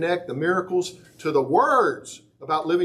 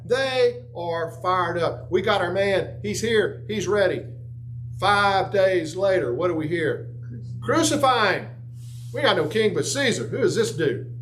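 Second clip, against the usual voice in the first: fired-up preaching; 7 semitones higher than usual. Acted. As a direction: preach it with rising fire, voicing the crowd. Preaching crowd scene.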